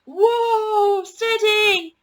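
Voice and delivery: high, loud call to rein in a horse; calling whoa to horse. A narrator's reading. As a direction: try to calm the wild horse. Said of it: "said Ben".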